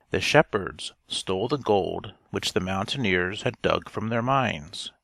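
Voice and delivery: ordinary delivery, nothing marked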